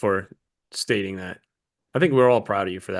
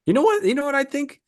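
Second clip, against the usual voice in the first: high excited squeal; 13 semitones higher than usual. Real, not acted. Sudden high excited outburst.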